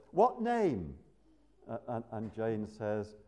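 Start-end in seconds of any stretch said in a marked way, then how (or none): 0.1-0.9 higher, louder brisk question; lightly voiced angel at the gate; angel asking. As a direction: gatekeeper checking names. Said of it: "the angel says".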